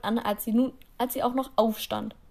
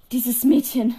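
schnaubend; 8 dB louder, slower than usual. A narrator's reading.

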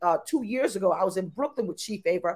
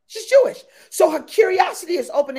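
usual speaking voice

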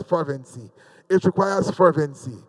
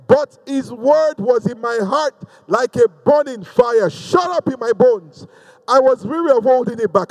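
higher-pitched, impassioned scripture proclamation; fervent preaching; 4 semitones higher than usual. Real, not acted. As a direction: proclaim the verse to rouse the congregation. Preaches the verse with fervour.

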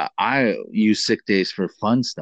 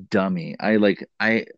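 normal talking voice

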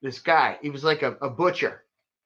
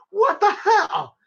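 high, slow incredulous cry; amazed; 4 dB louder, much slower than usual. Retold or imitated. Amazed the bartender bought it, incredulous. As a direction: stretch it out in disbelief.

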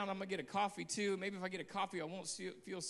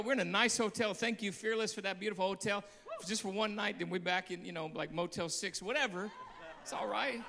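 ordinary talk, nothing marked

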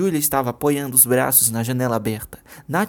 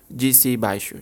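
ordinary delivery, nothing marked

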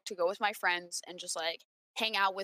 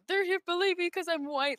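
mocking whiny voice; 10 semitones higher than usual. Mocking Mike's complaint.

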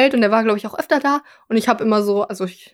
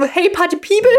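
party host call; 7 semitones higher than usual. Retold or imitated. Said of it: "hat er auch immer gesagt".

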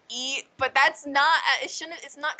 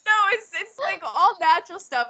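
high, laughing protest; 7 semitones higher, 4 dB louder than usual. Real, not acted. Laughing to her friend that it's all natural. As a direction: defend yourself while giggling.

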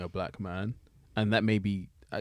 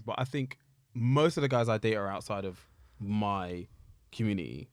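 ordinary delivery

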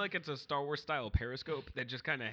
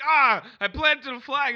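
slow, loud, high mocking brag; hammed-up bragging friend; 12 semitones higher, 16 dB louder than usual. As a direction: puff up like a dumb boast. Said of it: "you'll be like".

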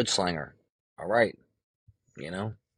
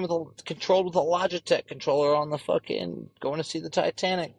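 mocking officials; 8 semitones higher than usual. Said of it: "They're like".

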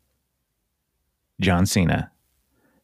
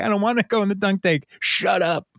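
put-on much higher, childish whine; whiny refusal; 9 semitones higher than usual. Cena refusing the dunk tank, high childish whine. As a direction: pout and whine like a kid. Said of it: "And he's like".

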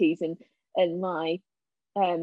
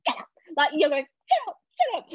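mimed silent shout; 7 semitones higher, 3 dB louder, faster than usual. Retold or imitated. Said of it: "shouting at you, but there's no sound coming out".

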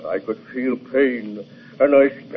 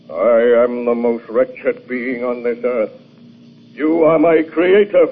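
ordinary talk, nothing marked

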